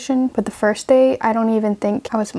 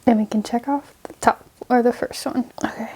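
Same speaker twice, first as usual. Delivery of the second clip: whispering; 4 dB quieter than usual. Real, not acted. Muttering to herself while typing.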